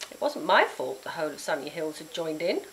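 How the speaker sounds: ordinary delivery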